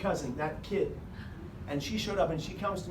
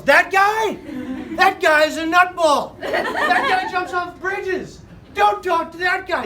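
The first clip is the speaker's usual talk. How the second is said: much higher, louder mock shout; imitating a yell; 13 semitones higher, 10 dB louder, much slower than usual. Kid yelling to Margaret about the comedian, loud mock shout. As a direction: yell it like a kid warning everyone. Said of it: "And he yells".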